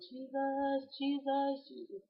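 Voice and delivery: high-pitched mock scream, fading at the end; imitated holler. Calling out while running to Jesus, imitated high holler. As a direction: re-enact a cry out, high but not full volume. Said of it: "I screamed, and I hollered".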